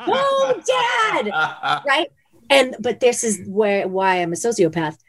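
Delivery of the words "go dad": high-pitched imitation of a screaming kid; mock scream. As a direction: cheer it in a very high mock yell. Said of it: "I kept screaming".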